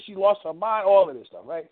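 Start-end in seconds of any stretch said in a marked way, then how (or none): none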